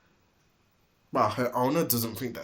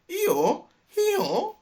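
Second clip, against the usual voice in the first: high, loud hee-haw bray; donkey bray; 7 dB louder than usual. A narrator's reading. Dilly brays a warning, high loud donkey hee-haw. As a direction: bray like a donkey to raise the alarm.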